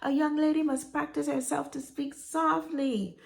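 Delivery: put-on high-pitched grandmother with Panamanian accent. Grandmother correcting a young lady, Panamanian-accented admonishing. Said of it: "in her Panamanian accent".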